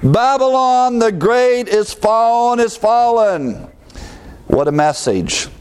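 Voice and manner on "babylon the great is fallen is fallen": high, slow, booming proclamation; loud voice booming forth. Acted. Angel announcing Babylon's fall, booming high and slow. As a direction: herald it in long ringing tones. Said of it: "here we have the loud voice".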